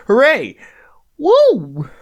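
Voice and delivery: high, loud celebratory cheer; excited cheer. Real, not acted. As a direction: whoop with real joy. Celebrating two thousand followers.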